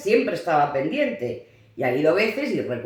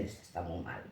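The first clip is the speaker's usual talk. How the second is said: much softer, lower gossiping murmur; gossiping whisper; 12 semitones lower, 8 dB quieter than usual. Retold or imitated. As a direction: whisper it behind her back. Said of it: "entre ellas se miran y dicen". (cuchicheo de las otras madres, muy bajo y cómplice)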